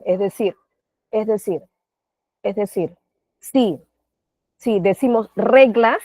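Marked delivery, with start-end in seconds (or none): 5.3-6.0 "reglas" with emphasis on "re"; stress on penultimate syllable. Shown as a demonstration. Teacher counting syllables from the end in reglas, "re" stressed. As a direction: punch the "re" of "reglas". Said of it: "la mayor entonación en reglas".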